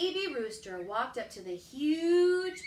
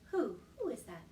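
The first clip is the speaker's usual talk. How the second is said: ordinary delivery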